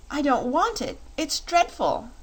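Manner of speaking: high, pleading refusal of the fox head; Dorothy refusing the fox head in disgust; horrified refusal. Acted. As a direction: recoil and refuse in horror. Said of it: "she exclaimed".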